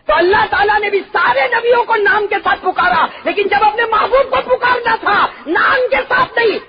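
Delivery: high, loud impassioned preaching; rapturous preacher's shout; fiery preaching. Acted. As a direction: cry out each title with rising passion. Preacher shouting Quran's forms of address.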